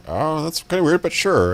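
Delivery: high, amused mock reply; high-voiced, shrugging agreement; imitating the voice actor. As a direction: pitch up to play the bemused actor. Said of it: "And he's like".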